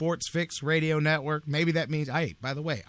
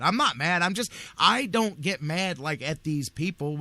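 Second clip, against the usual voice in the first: ordinary talk, nothing marked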